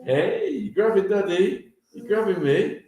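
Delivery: ordinary talk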